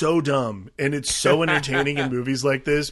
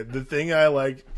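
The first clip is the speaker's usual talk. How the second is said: ordinary talk, nothing marked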